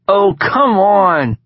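high, loud, slow cackle through the words; cackling. A narrator's reading. Sid mocking Knox's face, slow high cackle. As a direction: drag it out laughing at him. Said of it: "Sid cackled".

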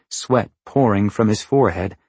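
usual speaking voice

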